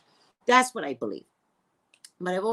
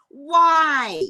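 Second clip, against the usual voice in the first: indignant why; 7 semitones higher, 11 dB louder than usual. Retold or imitated. Indignant at cramping warning.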